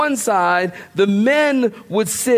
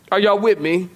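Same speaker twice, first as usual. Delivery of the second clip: normal talking voice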